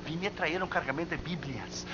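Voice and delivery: soft repeat of another's outburst; acted hushed quoting. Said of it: "No puedes hablar un poco más bajo".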